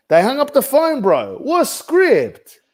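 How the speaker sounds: high-pitched comic outrage; incredulous complaint. Real, not acted. Agent complaining to coach about the failed script, high-pitched disbelief. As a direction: protest in disbelief, voice leaping up.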